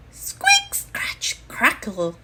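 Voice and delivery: whispered squeak, scratch and crackle; whispered hatching sounds. Acted. The egg cracking open in the nest, whispered sound effects. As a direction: breathe the sounds of the shell breaking.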